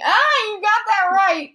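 high-pitched villain voice; hammed-up mean taunt in a high voice; mean gloating character. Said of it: "Snap started loafing meanly".

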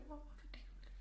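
very soft whisper to oneself; whispered musing. Real, not acted. Guest tries to recall which shop, whispering to self. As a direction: whisper it while searching memory. Asks herself, trying to remember.

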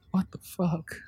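breath-only disbelief; stunned whisper. Acted. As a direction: whisper it in shocked grief. Stunned grief after refusal.

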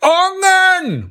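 dad yelling "Brænd ungen" very slow and high; shouting at full voice. Acted. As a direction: bellow it slowly across the hall. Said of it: "prøver far igen".

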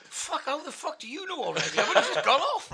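high, increasingly loud mock disbelief; laughing disbelief. Man stunned that they already know, laughing disbelief.

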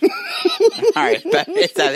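high, breaking into real laughter; words squeezed out through laughing. Real, not acted. Friend laughing after a crude podcast joke.

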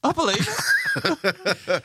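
stretched-out, high laughing "I believe it". Real, not acted. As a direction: drag it out through laughter. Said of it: "laughing reply".

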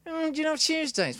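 high-pitched mock amateur stand-up; open mic comic. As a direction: parody a hesitant open-mic comedian. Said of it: "sort of open mic night".

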